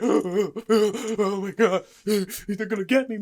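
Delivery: gasping, loud and high fright; breathless mock terror; imitated panic. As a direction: gasp it out like a hunted man.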